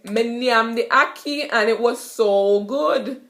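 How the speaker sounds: "Me nyam the ackee" in Jamaican patois; easy Jamaican patois brag about food. Shown as a demonstration. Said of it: "you could say".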